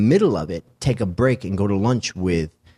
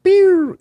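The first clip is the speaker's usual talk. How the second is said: imitated siren; 9 dB louder than usual. Ambulance siren arriving.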